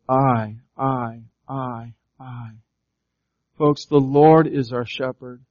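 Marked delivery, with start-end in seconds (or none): 0.1-2.5 each "I" set apart, low and deliberate; repeated I stressed. Shown as a demonstration. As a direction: count out every "I" slowly. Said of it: "Just look how many times he says".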